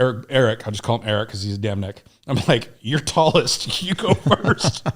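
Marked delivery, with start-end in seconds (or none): 2.8-4.9 slow, higher, breaking into a laugh; slow mock command with laughter; joking retold order. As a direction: draw it out slowly, amused, as a retold order. Jokingly retold order before roof drop.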